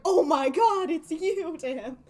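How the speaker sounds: ordinary delivery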